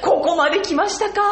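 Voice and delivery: loud, high excited exclamation; high-spirited. Retold or imitated. Guest imitating overjoyed puppeteer, high-tension and loud. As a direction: mimic someone hugely thrilled. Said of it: "すごいハイテンション".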